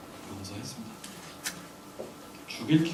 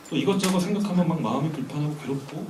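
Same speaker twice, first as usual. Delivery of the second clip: usual speaking voice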